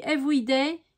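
English motto with a thick French accent. Real, not acted. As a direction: read English as a French speaker would. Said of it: "avec mon accent anglais". (anglais avec un fort accent français)

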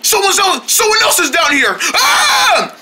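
high-pitched panicked yelling; gasp, then scream in mock alarm; startled high shriek. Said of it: "who's screaming".

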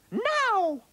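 piercing high shout of now. A narrator's reading. Little fish shouting the signal to the shoal, high and loud. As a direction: yell the signal at full strength. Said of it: "then shouted".

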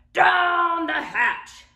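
crew shout. Acted. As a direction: roar it back as the crew. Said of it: "we all shouted".

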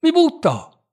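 shrieked threat at the edge of a cliff; screaming. A narrator's reading. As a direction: hurl the threat at him in a scream. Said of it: "gridò".

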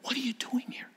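soft unvoiced whisper. God coming close to Elijah, whispering. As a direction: whisper as if right beside them. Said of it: "came real close and whispered".

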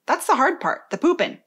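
"the" lifted high, "hard part" falling. Shown as a demonstration. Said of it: "Poo is the hard part".

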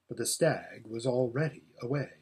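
ordinary delivery

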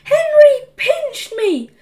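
high, loud, slow whine; little brother wailing to mum. Acted. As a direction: tell on him, wailing. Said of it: "wailed Peter".

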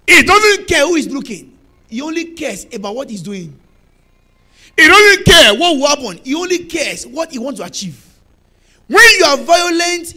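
loud, high surges; fiery sermon; preaching at full force. Acted. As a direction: hammer each claim.

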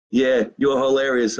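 ordinary delivery

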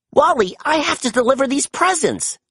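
high, urgent yelp. A narrator's reading. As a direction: pitch it high like a pup in a hurry. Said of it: "Zuma yelped".

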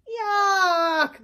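long, loud, high yuck; disgusted cannibal. Acted. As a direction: draw out a hammed-up cry of disgust. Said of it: "The cannonball is saying yuck".

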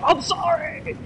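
very high, squeaky imitation; mock apology. Acting out Toph's apology.

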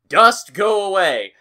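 loud, high, drawn-out command; re-enacted shout. Player shouting into the mic to clear dust, re-enacted shout. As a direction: shout the dust away, slowly. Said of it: "I literally just shouted into the mic".

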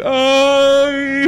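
very high, long drawn-out "ay"; stretched squeal of disgust; agonized comic wail. Acted. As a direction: wail as the bad breath hits you. Husband hit by her breath.

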